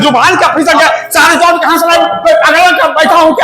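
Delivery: high, exasperated retort; indignant outburst. Acted. Teacher rejecting students' slip-of-the-tongue excuse, indignant outburst.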